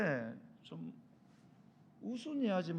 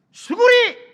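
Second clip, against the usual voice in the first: frantic, very loud high yell; panicked shout; urgent shout; 21 dB louder, much slower than usual. Acted. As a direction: scream a warning to duck. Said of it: "너무 급해가지고 소리를 쳤습니다".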